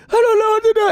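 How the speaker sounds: very high, loud panicked wail; mock panic. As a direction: act out a teenager sure he's dying. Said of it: "I think I'm dying".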